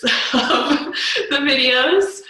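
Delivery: loud, high, laughing through the words. Real, not acted. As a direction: let a real laugh break into the phrase. Amused admission of video retakes.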